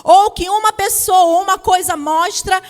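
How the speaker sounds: very loud, slow declamation; full-force preaching. Real, not acted. Preacher declaring the meanings of power to the congregation, full-force preaching. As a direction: proclaim each phrase at full volume, drawn out.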